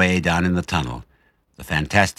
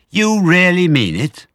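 slow, high, loud amazed question; hoping it's true; disbelieving; 13 semitones higher, 9 dB louder, slower than usual. Acted. Badger asking Fox if he means it, high amazed.

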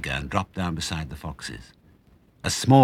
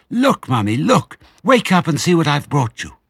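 high, loud, tumbling out of breath; breathless excitement; 11 semitones higher, 7 dB louder than usual. Acted. Small fox showing his mother the hens, breathless and thrilled. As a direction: show her the prize, thrilled. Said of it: "he cried out of breath".

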